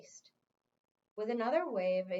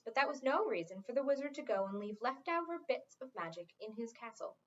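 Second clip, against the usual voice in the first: ordinary delivery, nothing marked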